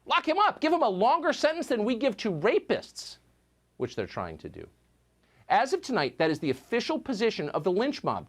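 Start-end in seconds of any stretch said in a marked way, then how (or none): 0.0-3.2 high-pitched hysterical imitation; shrill-pitched mocking accusation; mock outrage. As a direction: mimic a lynch mob baying for punishment. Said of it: "What C N N is telling us".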